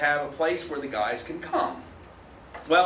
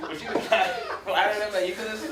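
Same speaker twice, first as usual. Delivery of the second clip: put-on laughing Southern antebellum accent; 4 semitones higher than usual. Lecturer redoing the suitor's line for students, laughing Southern accent. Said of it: "it's like I'm antebellum".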